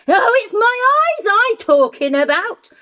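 loud, high-pitched indignant roar; giant's roar. Acted. Giant bangs the table at Jim, roaring. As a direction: bellow it as an exasperated giant. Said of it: "roared the giant, banging his fist".

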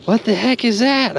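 ordinary talk, nothing marked

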